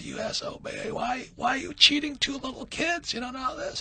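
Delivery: high-pitched retold kid's outburst, partly whispered; imitating a crying, screaming boy; mock tearful yell. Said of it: "I started crying and screaming at him".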